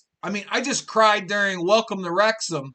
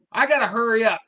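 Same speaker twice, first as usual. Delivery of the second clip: ordinary talk, nothing marked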